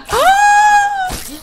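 very high, loud gasp of horror; a piercing horrified gasp; shocked gasping scream. Real, not acted. Sees father killed.

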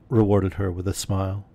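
usual speaking voice

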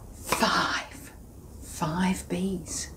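unvoiced whisper on the count. Presenter whispering as the bees hide. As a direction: hush it down to a whisper.

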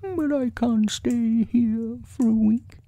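high, worried bear voice; storybook bear, pitched high and fretful; Pooh. A narrator's reading. Pooh asking if he is stuck forever, high voice.

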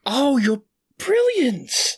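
high, drawn-out delighted realisation; amazed praise. Real, not acted. Co-host reacting to clever theory, amazed high gush. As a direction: gush at a brilliant insight.

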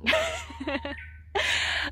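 high, light giggle; real high-pitched chuckle. Real, not acted. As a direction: let out a high little laugh. Laughs after her own opinion.

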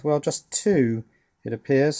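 ordinary delivery

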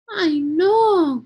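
high, loud cry of dismay; loud, swooping "oh no" exclamation; dismayed shout. A narrator's reading. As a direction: shout it like an alarmed storybook girl. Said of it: "gritó Julia".

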